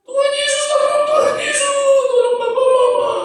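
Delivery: put-on very high, loud wailing child; child's desperate prayer. Child praying for fighting papa and mama, desperate wail. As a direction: cry it out like a praying toddler. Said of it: "Anak ini berdoa nggak?".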